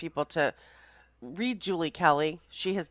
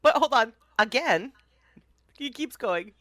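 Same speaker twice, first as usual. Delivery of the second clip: excited; 9 semitones higher, 6 dB louder than usual. Real, not acted. Cohost laughing excitedly, interrupting.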